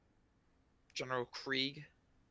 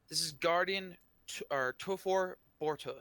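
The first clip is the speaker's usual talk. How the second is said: raised, loud formal introduction; projected ceremonial announcement; booming voice; 5 semitones higher, 5 dB louder than usual. Acted. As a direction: present a dignitary from the podium. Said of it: "the voice booms".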